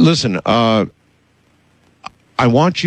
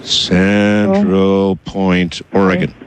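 very slowly spell out Central Point, Oregon, word by word; slow dictation; 3 semitones lower, much slower than usual. Shown as a demonstration. Host repeating the address slowly for the caller, very slow dictation. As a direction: Central Point said at a crawl, each syllable drawn out for writing down.

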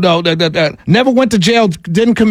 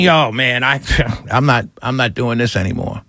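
fed-up rural guy; 11 semitones lower, 5 dB quieter than usual. Retold or imitated. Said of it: "And he's saying".

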